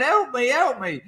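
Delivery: high-pitched mock cry for help; mate pleading. Stuck mate begs his friends for help, mock panic. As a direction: whimper it like a trapped kid. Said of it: "my mate's going".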